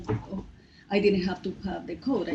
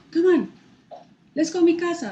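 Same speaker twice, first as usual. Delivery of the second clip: stranger's call; 10 semitones higher, 9 dB louder than usual. Retold or imitated. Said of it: "and he like".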